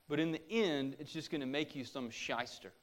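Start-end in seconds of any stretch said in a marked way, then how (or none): none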